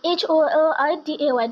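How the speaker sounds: quick rattled-off letters; fast run-through spelling. Shown as a demonstration. Teacher rushing through the spelling of holiday for the class, fast. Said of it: "প্রনাউন্সিয়েশনটা break করে".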